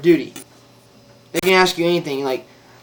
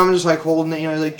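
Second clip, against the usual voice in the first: normal talking voice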